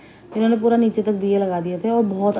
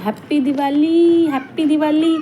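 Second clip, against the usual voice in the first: high, slow, drawn-out happy shout; a kid yelling greetings at the fireworks; excited child shouting; 7 semitones higher, slower than usual. Retold or imitated. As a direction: call it out like a thrilled little boy. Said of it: "ज़ोर ज़ोर से चिल्ला रहा था".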